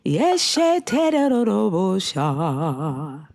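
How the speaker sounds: slow, drawn-out fake tongues babble; mock speaking in tongues. Host offering to pray for a guest, mock speaking in tongues slowly. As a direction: ham up a gibberish prayer.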